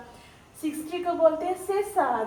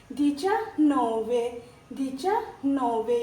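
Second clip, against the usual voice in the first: slow model words; slower than usual. Shown as a demonstration. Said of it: "ये base याद होना चाहिए".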